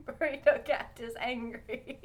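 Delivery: normal talking voice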